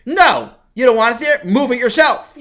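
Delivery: loud, high bratty refusal; defiant shout. Acted. Sammy refuses his father's request, defiant shouting. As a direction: yell back like a defiant child.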